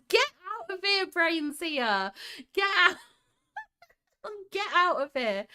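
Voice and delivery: high, loud burst of happy disbelief; amazed disbelief. Real, not acted. Streamer stunned by the flower shop detail, exclaiming. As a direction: exclaim it, astonished, nearly laughing.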